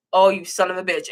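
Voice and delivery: usual speaking voice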